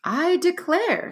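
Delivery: high, slow amazed exclamation; genuine surprise. Acted. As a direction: marvel at it slowly. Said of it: "in genuine surprise".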